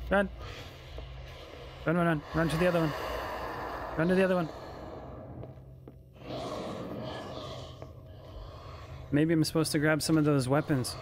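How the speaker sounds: panicked, rising pitch; being chased. Real, not acted. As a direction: scramble to run away in fear. Chased by a monster in-game.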